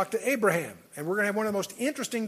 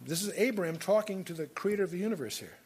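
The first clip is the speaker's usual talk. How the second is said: normal talking voice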